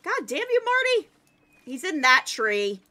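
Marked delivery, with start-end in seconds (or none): 0.0-1.1 higher hammed-up curse at Marty; mock outrage. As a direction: shake your fist at Marty, playfully. Cursing the fleeing game creature.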